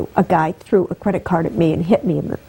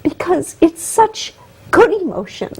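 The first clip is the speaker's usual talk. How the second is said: high, teary voice on the edge of crying; slow, fighting back real tears; tearful; 8 semitones higher, slower than usual. Real, not acted. Guest explaining her emotions, tearfully. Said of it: "that's why I cry all the time".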